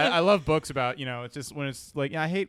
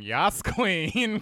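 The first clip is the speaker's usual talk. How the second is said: sassy Yas Queen; 6 semitones higher, 4 dB louder than usual. Retold or imitated. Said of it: "I impress girls by going".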